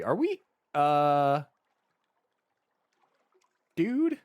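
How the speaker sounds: high startled dude; alarmed. Real, not acted. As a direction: squeak it out in alarm. Alarmed at furious king.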